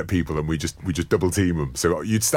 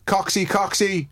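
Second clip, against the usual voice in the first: put-on Australian accent; 10 semitones higher than usual. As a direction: do Tempo in an Aussie voice. Impersonating Tempo's voice.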